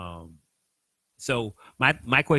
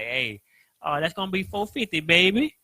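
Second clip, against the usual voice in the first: imitated old lady, higher pitch, lilting up on baby; 6 semitones higher than usual. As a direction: mimic an old lady at the counter. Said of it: "the old lady is like".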